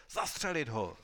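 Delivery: a loud shouted death order; marshal shouting. A narrator's reading. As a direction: bark the sentence with full force. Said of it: "ba spíš křičel velký maršál".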